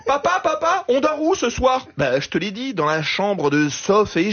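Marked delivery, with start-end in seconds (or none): 0.0-1.9 put-on much higher child voice calling dad; child's voice. Comic voicing a child asking his father on the plane, high. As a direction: pipe up like a little kid asking dad. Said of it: "Ou alors".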